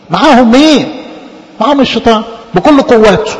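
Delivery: usual speaking voice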